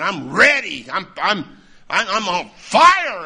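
loud, emphatic burst of youthful zeal; fired-up eagerness. Retold or imitated. Retelling his youthful eagerness to preach.

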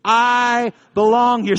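loud, high, drawn-out call; rallying declaration. Shown as a demonstration. Preacher to congregation, say it with me, loud slow declaration. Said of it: "I want you to say it with me".

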